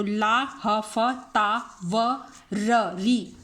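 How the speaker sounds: very slow, each syllable split apart; broken into syllables, very slow. Shown as a demonstration. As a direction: spell it out one syllable at a time. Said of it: "इससे याद हो जाएगा".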